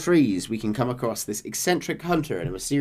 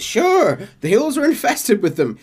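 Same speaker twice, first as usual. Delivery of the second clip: high, louder hillbilly hunter voice; eccentric hunter; 5 semitones higher, 3 dB louder than usual. Retold or imitated. Hunter tells John about Sasquatches, high and loud. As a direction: play the wild-eyed backwoods hunter.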